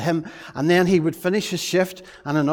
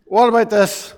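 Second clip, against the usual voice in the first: usual speaking voice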